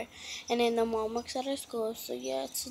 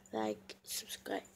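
slow, much lower whisper; 14 semitones lower, 8 dB quieter, slower than usual. Real, not acted. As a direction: murmur the reminder slowly. Whispered reminder.

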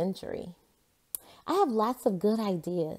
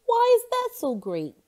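much higher, louder puzzled question; puzzled Jasper; 8 dB louder than usual. A narrator's reading. Jasper questioning Margaret, much higher and louder. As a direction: squeak it up in disbelief. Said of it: "Jasper asked".

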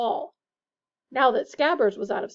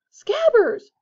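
high, loud shout after the rat; 13 semitones higher, 11 dB louder than usual. A narrator's reading. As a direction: yell his pet's name. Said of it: "Ron shouted".

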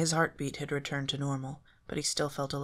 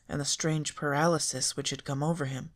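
ordinary delivery

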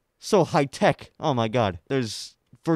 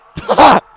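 loud burst of laughter; 19 dB louder than usual. Real, not acted. Sudden burst after shouting dude.

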